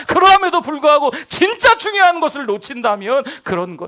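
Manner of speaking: fiery sermon delivery; full-force preaching. Acted. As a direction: preach it hard to the congregation.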